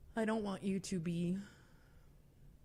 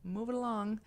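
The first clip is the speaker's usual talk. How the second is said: high, lightly voiced brush-off; polite, singsong dismissal; kindly husband; 8 semitones higher than usual. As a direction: mildly humor a stranger and send him off. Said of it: "very kind, very sweet, was like, kinda like".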